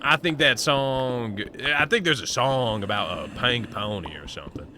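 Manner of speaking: lightly voiced southern cowboy; a hint of a southern cowboy accent. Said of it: "talking like this".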